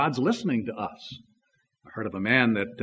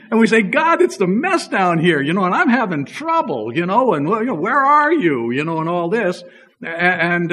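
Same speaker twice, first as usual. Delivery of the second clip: loud, high, impassioned preaching; preaching at full force; 6 semitones higher, 6 dB louder than usual. Acted. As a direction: pour it out to the congregation, louder and higher.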